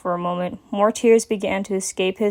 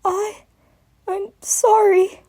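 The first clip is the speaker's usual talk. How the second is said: high, slow, broken little voice; weepy child voice; tearful apology; 12 semitones higher, slower than usual. A narrator's reading. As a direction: sob it out. Crying dragon apologizes.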